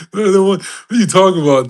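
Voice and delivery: giggly and high; laughing. Real, not acted. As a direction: still cracking up. Still laughing, voice up.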